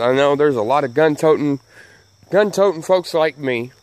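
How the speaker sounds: ordinary talk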